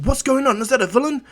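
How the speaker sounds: high, loud panicked questions; alarmed cry. Acted. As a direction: call out in alarm over the destruction. Prism Mike alarmed at collapsing school.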